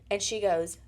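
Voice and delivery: ordinary talk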